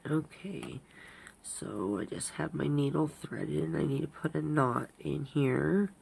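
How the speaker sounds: stuffy nose from allergies; blocked-nose nasal speech. Real, not acted. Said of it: "sorry I sound a little nasally".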